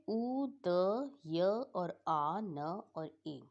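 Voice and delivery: letters drawn out one by one; careful slow dictation; slow spelling out. Shown as a demonstration. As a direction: spell each letter slowly for the class. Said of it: "इसको बहुत ध्यान से करना है".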